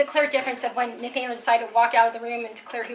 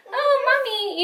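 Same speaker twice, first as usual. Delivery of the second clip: much higher, babyish imitation; mock little-kid voice; 11 semitones higher, 3 dB louder, slower than usual. Imitating a typical child to mommy, mock babyish. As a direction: put on a small child's voice. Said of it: "he's not like".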